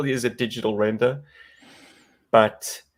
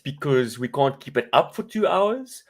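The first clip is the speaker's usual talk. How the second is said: put-on South African accent; 4 semitones higher, 5 dB louder, faster than usual. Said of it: "we water down the South African accent".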